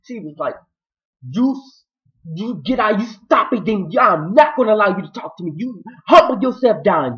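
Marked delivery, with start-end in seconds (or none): 1.2-7.2 loud, high church-style rebuke; acted fierce rebuke. Fed-up Hannah commanding a demon to humble himself, fierce rebuke. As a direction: lay down the law, loud and pitched way up.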